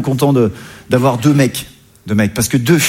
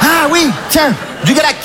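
answering a dolphin; 13 semitones higher than usual. Pretend. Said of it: "Qu'est-ce que tu dis, Flipper?".

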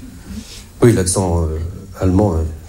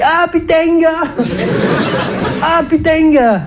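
high-pitched, hammed-up southern French accent; Provençal accent; slower than usual. Doctor announcing the diagnosis to his patient, hammed-up Provençal accent. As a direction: exclaim in a broad mock Provençal accent. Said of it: "son accent chaleureux et rassurant".

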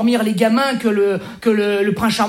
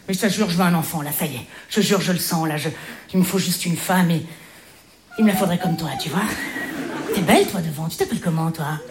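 softer seductive pitch; 7 semitones lower, 5 dB quieter than usual. Acted. Drague une spectatrice.

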